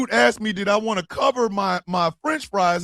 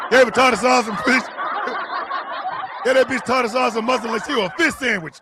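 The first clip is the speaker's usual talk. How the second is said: laughing; 4 semitones higher than usual. Real, not acted. Repeating the joke while laughing.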